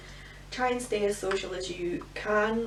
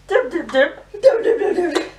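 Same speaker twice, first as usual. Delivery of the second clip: giggly sing-song; 8 semitones higher, 13 dB louder than usual. Real, not acted. Playing with blusher brush, laughing.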